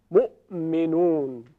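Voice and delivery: مؤمنون slow, with the glottal stop held before the release; hamza demo. Shown as a demonstration. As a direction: say مؤمنون slowly, closing the throat first. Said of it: "تا بازش نکنی نمیتونی دیگه صحبت کنی". (مؤمنون با همزه کشیده)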